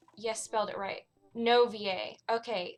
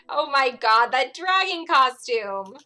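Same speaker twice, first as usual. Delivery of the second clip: loud, drawn-out gushing; loud delighted outburst; excited exclamation; 2 semitones higher, 8 dB louder, slower than usual. Acted. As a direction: exclaim over an adorable photo, stretching the last words. Exclaims over childhood costume photo.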